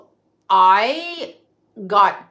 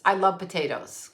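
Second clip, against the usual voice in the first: ordinary talk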